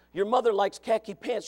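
ordinary talk, nothing marked

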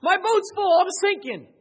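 high, loud despairing cry; a loud high cry of giving up; voicing a discouraged pastor. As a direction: wail that you're going under. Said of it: "and say".

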